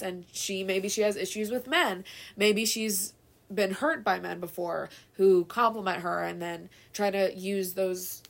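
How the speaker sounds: normal talking voice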